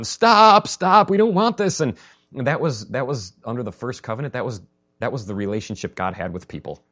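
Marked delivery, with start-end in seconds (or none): 0.0-1.8 high, loud panicked cry of stop; hammed-up terror, shouting to make it stop; mock panicked shout. Frightened people at the mountain begging, in a mock panicked shout. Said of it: "everybody's just freaking out and going".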